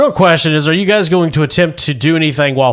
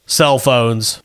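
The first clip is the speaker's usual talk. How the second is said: ordinary talk, nothing marked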